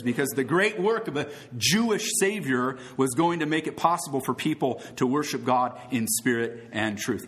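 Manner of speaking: usual speaking voice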